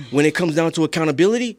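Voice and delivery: ordinary talk, nothing marked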